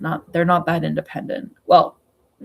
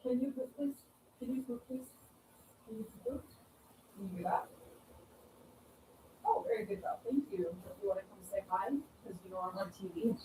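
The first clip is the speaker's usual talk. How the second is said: distant, much softer coaxing; away from microphone; 3 semitones higher, 19 dB quieter, faster than usual. Real, not acted. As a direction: talk softly to a pet across the room. Away fetching and coaxing the bird.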